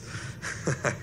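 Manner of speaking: short laugh at your own story; amused laugh. Real, not acted. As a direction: laugh briefly, without words.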